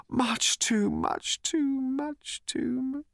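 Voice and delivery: high, slow, fading moan. Acted. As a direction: moan it softly, fading away. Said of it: "moaning quietly".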